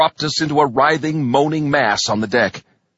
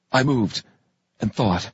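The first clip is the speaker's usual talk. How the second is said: ordinary delivery, nothing marked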